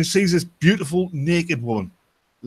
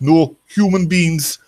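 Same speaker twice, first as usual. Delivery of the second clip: slow, emphatic Jamaican punchline; Jamaican accent; 4 semitones higher, 5 dB louder, much slower than usual. Acted. Jamaican prisoner corrects the Scotsman, slow emphatic Jamaican accent. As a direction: draw it out loudly in a Jamaican accent. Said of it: "And the Jamaican goes".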